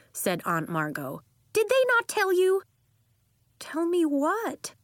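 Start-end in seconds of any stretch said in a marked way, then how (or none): 1.5-2.6 high, loud disbelieving question; astonished, raised-voice query; incredulous aunt. A narrator's reading. Aunt incredulous at family's silence. 3.6-4.7 put-on high, slow little-girl question; tiny, uncertain child voice. A narrator's reading. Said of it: "replied in a tiny voice".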